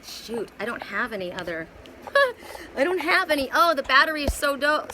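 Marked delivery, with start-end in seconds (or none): 2.0-2.7 a sudden high burst of laughter. Real, not acted. Reader laughing at her dying phone battery, high burst of laughter. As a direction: laugh it off, caught without a charger.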